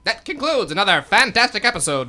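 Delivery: radio announcer sign-off; announcer outro. Acted. Announcer to listeners, closing show sign-off. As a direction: wrap it up like an old radio show.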